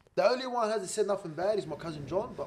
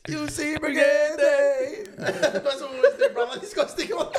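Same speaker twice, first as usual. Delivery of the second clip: high, loud giggly talk; words bubbling with laughter; laughing; 10 semitones higher, 7 dB louder than usual. Real, not acted. Host cracking up at his co-host.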